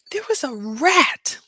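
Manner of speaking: slow, high, dread-filled reveal; horrified reveal. Real, not acted. Telling viewers about the airport rat, slow high horror. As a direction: draw it out in horror.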